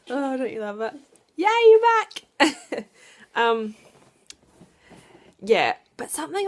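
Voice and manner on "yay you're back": high, very loud, drawn-out yay; excited cheer. Pretend. Mum cheering her child on, excited. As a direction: cheer big and slow for a little one.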